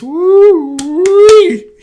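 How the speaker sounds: high, loud woo-wee sign-off; excited cheer. Real, not acted. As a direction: sing out the catchphrase.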